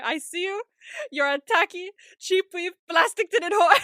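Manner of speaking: high, loud shout building to a climax; mock furious yell. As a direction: hurl the insult at another driver, hammed up. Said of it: "he yells at her".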